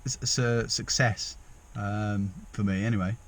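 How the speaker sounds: ordinary delivery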